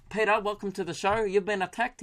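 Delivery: high mock Kiwi radio host; New Zealand accent. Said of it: "I've got it now".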